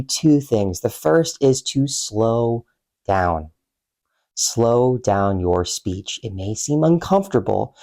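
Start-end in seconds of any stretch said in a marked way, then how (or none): 1.9-6.2 very slow, deliberate words; measured coaching, drawn out with pauses. Shown as a demonstration. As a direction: stretch each word out to model slower speech. Coach illustrates slowing down speech.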